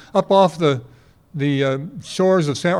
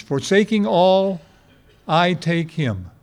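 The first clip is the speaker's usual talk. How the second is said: slow spelled-out phrase; slower than usual. Shown as a demonstration. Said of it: "Faith is an acronym".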